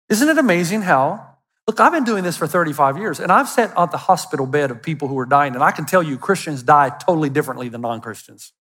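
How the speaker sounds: ordinary talk, nothing marked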